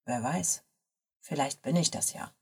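low, soft confession; murmuring. A narrator's reading. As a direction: murmur it low and very softly to the friend. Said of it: "sah zu ihr herüber und murmelte". (tief und leise gemurmelt)

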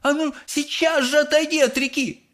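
high-pitched motherly holler; Mrs Gloop. A narrator's reading. Mother orders son from river, high holler. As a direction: order the child away in a high voice. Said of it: "заорала миссис Глуп".

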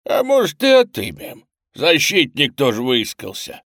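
loud, high jeering menace; threatening. A narrator's reading. Robber mocking the girl's defender, threatening. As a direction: taunt him loudly, spoiling for a fight. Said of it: "угрожающе произнёс один из грабителей".

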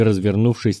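ordinary delivery, nothing marked

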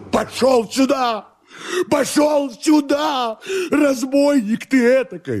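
very high, hysterical shouting; enraged command. Acted. Master orders servant back, hysterical high shout. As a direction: scream the order slowly, voice far up.

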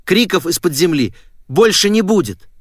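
high, excited announcement; jubilant. A narrator's reading. Girl phoning her friend with good news, jubilant.

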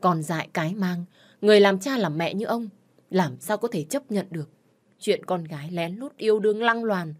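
ordinary delivery, nothing marked

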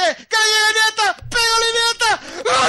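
yelling as the goal goes in; goal shout. Real, not acted. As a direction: scream it as the ball hits the net. Iniesta scores the winning goal. (grito de gol)

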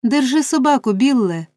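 high urgent cry to hold the dog; frantic cry. A narrator's reading. Nancy cries to Bill to hold the dog, frantic. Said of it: "скрикнула Ненсі".

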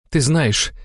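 high, tight accusing tone; spoken through clenched teeth. A narrator's reading. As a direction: force it out with contempt. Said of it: "процедила Алла". (процедить сквозь зубы)

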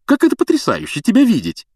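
very high, loud gushing; hammed-up rapturous greeting; mock delight. A narrator's reading. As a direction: pretend to be thrilled to see her. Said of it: "словно только увидел её".

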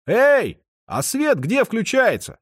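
high, loud call into the dark; shouting. Acted. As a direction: yell to someone far off. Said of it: "проорал Антон конюху". (крик в темноту)

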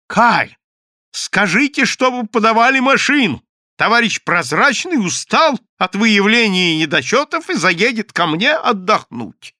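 high, loud commanding call. A narrator's reading. Евсей calls to Доброгласов, loud and high. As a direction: order the car, shouting across. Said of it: "крикнул".

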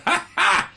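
high-pitched, forced "ha ha"; put-on staccato laugh; mock laugh. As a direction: imitate yourself laughing in someone's face. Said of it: "You just see me go".

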